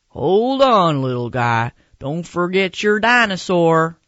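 loud bakery counter voice; calling out. Acted. Bakery lady stopping the boy with his donuts, calling out loudly.

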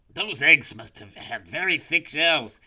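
high, loud puzzled snake; snake character. A narrator's reading. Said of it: "he said to himself".

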